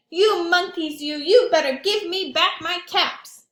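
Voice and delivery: high, loud, furious yell; angry shout. Acted. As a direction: shake both fists and yell at them. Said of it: "he said".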